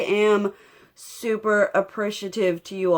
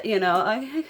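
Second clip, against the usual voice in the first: ordinary talk, nothing marked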